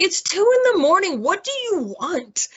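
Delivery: a high, put-on groggy irritation; mock exasperated. Woken patient snapping at the nurse, mock annoyed.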